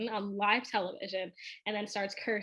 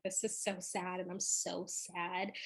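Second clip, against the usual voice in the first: quiet sadness; 4 semitones lower, 10 dB quieter than usual. Retold or imitated. Retelling her instant sad reaction.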